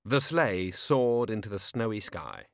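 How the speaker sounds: ordinary delivery, nothing marked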